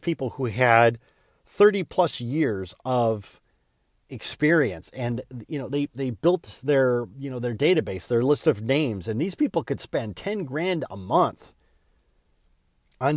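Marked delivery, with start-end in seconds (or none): none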